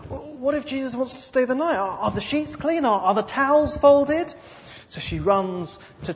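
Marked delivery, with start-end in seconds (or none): 0.1-4.3 flustered worry. Acted. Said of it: "But then she thinks".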